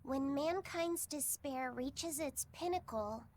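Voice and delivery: slow, low reading of a prophecy; solemn recitation. Acted. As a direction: recite an ancient text gravely.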